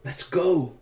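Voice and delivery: put-on high, urgent little-girl call; child's call. A narrator's reading. Girl interrupts George's thoughts, calling out. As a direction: break in with a lively call. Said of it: "interrupted by the familiar".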